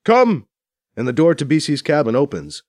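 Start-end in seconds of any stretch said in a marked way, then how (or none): 0.0-0.5 loud sharp call; shouting. Acted. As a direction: call out the command loudly. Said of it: "the Eldred shouts out".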